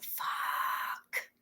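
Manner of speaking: soft whispered swear under the breath; whispered curse. Real, not acted. Muttered curse at a glitch.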